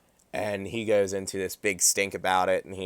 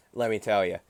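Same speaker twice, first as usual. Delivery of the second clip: ordinary talk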